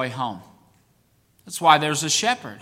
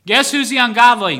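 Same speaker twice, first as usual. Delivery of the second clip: louder, punching ungodly; emphatic question; 5 semitones higher, 7 dB louder than usual. Real, not acted. Preacher confronting the congregation, loud and emphatic. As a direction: challenge them with it.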